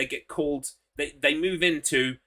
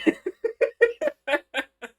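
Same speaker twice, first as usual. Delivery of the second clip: high cackling laugh. Real, not acted. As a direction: burst into a high helpless cackle. Laughing at own joke.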